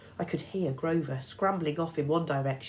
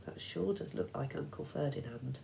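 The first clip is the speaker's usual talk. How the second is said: mumbled; 7 dB quieter than usual. A narrator's reading. Boy mumbling to himself beside the statue, softly. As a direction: mumble it under your breath.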